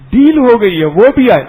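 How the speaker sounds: Deal called out high and loud; excited exclaim. Retold or imitated. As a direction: announce the deal with glee. Children's deal, louder retake.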